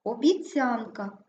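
Обіцянка stressed as обі-ЦЯН-ка. Shown as a demonstration. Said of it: "наголос падає на Я". (Обіцянка з наголосом на ЦЯН)

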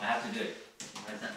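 "have" stressed but low and soft; quiet. Shown as a demonstration. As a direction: murmur it, "have" barely leaned on. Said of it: "just say".

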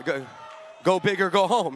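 ordinary talk, nothing marked

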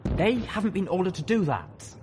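high-pitched, urgent plea; pleading. A narrator's reading. Colonel admits his hellhounds won't help, pleading.

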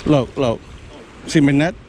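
ordinary talk

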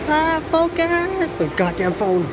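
high drawn-out frustrated cry; exasperated at the phone. Real, not acted. Phone camera fails to focus.

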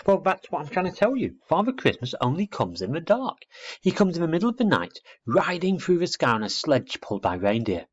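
put-on high, animated childlike explanation; earnest little girl. Little girl telling owlet about Father Christmas, animated. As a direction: build the wonder, eager to convince.

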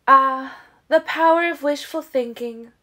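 high, loud scoffing sarcasm; mock the hope loudly; sarcastic. Siobhan answering Carlyle's praise with loud sarcasm. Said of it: "she answered sarcastically".

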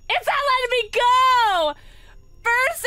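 high, loud protest; loud, high-pitched complaint at a game; frustrated yell. Real, not acted.

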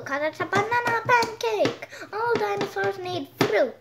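a hungry plant eater begging for fruit; hungry dinosaur voice. Pretend. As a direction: kid playing a plant-eating dino, high and eager.

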